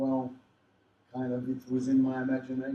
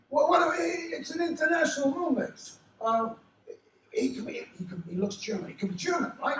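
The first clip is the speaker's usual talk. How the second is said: high, loud acted-out brainwave; imitating an insistent producer, high and urgent; excited producer; 3 dB louder than usual. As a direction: pitch the idea in an excitable, raised voice. Acts out producer arguing for casting.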